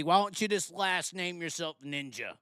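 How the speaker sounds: normal talking voice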